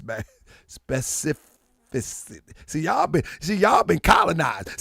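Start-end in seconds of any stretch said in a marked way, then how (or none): 0.7-2.4 slow careful attempt. Shown as a demonstration. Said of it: "I can't pronounce some of these European words".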